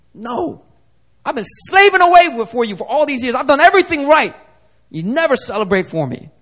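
acted indignant outburst, high and loud; angry older brother. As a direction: burst out in aggrieved protest, easing at the end. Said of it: "He was angry".

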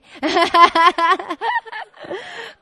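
loud, high burst of laughter; long, real cackling laugh; hearty laugh. Real, not acted. Laughs at own Aussie bit.

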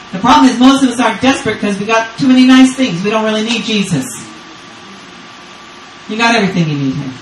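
usual speaking voice

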